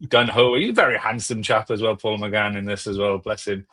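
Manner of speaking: ordinary delivery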